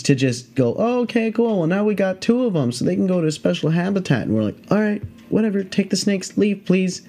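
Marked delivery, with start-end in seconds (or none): 0.8-4.2 higher-pitched, breezy handler voice; unbothered snake handler. Retold or imitated. 4.7-7.0 mock-dismissive reply, pitched up. Wanting handler to leave.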